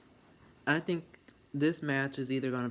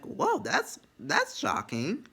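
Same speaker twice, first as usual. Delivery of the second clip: mock surprise; 10 semitones higher, slower than usual. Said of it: "I'm like".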